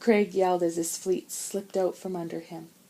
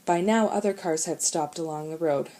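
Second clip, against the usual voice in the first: usual speaking voice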